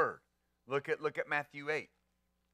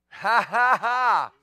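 loud, higher burst of delighted laughter; joyful laugh; 5 semitones higher, 10 dB louder, slower than usual. Real, not acted. Pastor bursting into joyful laughter before the church, loud. Joyful outburst mid-sermon.

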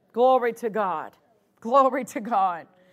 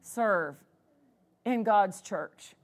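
grateful attitude; 3 semitones lower, 6 dB quieter than usual. Shown as a demonstration. Preacher models a servant's attitude to church, softly grateful. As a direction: speak it like a quiet, thankful vow. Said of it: "That needs to be our attitude".

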